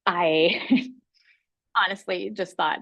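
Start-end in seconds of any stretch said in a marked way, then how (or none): none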